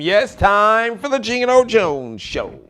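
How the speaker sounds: loud, high hype host opening; show host intro. Acted. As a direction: open the show big, pumping up the crowd.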